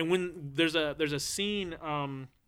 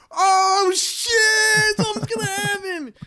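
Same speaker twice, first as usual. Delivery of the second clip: mock excited kid; 7 dB louder, much slower than usual.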